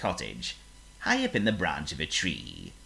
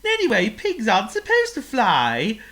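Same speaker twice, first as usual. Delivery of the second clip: a tiny bird sighing for the sky; wistful little bird voice; little bird wishing; 9 dB louder than usual. Acted. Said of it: "said Chirp".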